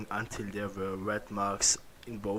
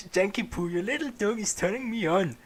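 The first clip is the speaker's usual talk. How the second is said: a higher hammed-up dad voice; lightly voiced mock father; 9 semitones higher, 4 dB louder than usual.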